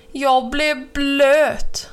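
slow, emphatic squawk of grievance; indignant complaint. A narrator's reading. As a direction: stretch each word out in outrage. Crow splashed by huge bellyflop.

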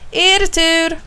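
high call to a group. A narrator's reading. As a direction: summon the children for their turn. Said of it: "ropar Karim till barnen". (ropande till barnen)

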